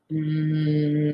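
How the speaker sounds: soft hummed "Mm", lips closed; teacher isolates the m sound. Shown as a demonstration. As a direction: hum "Mm" on its own before joining the a. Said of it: "separar el sonido mm".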